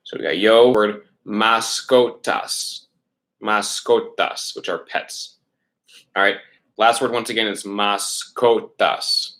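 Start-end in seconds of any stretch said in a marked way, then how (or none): 1.3-4.5 slow, each syllable separate. Shown as a demonstration. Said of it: "take it syllable by syllable". 7.6-9.3 slow, each syllable separate. Shown as a demonstration. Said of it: "take it syllable by syllable".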